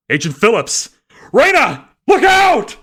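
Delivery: rising to a frantic high scream; shouting. A narrator's reading. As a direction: yell the warning in panic. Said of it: "he shouted".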